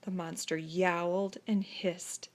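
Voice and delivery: ordinary delivery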